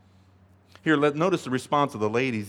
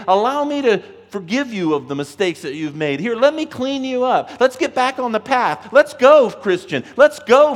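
higher, louder, eager call to come along; rallying invitation; 8 semitones higher, 8 dB louder than usual. Retold or imitated. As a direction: cheer the listener on to get going. God's inviting voice voiced.

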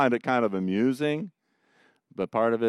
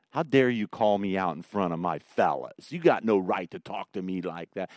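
ordinary talk, nothing marked

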